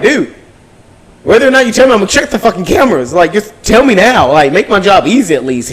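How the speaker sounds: mock furious store clerk calling him out; indignant retold confrontation; retold angry confrontation. Confronting shoplifter, retold anger.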